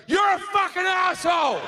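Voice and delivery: high-pitched yell of outrage; angry shout. Acted. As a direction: shout it right in his face, high. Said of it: "she'll go".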